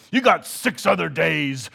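loud, scolding synagogue leader; the infuriated leader yelling at the crowd; angry shout. Retold or imitated. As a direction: bark it at the crowd like an outraged official. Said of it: "he shouted angrily at the crowd".

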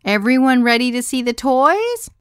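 big, high, loud party-host call; rally the room like a kids' party clown; clown-like enthusiasm. A narrator's reading. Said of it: "with the enthusiasm of a clown".

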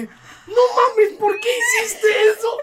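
high, loud outraged laugh; laughing mock outrage. In-laws imitated scolding the father, laughing. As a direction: gasp out the protest while laughing.

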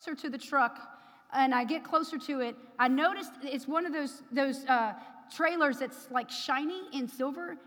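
ordinary delivery

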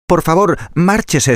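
high, pleading little piglet. Acted. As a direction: beg like a scared little pig. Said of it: "dijo el cerdito rosado".

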